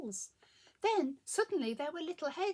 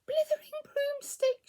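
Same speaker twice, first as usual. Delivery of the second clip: high, loud witch's outburst; shouted exclamation; 10 dB louder than usual. A narrator's reading. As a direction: cry out in exasperated dismay. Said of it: "shouted Winnie".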